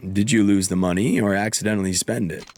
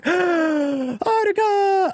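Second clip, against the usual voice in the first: gasped, much higher, drawn-out call; surprised call; much slower than usual. Acted. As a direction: call her name in delighted shock. Kiryu spots Haruka, gasping.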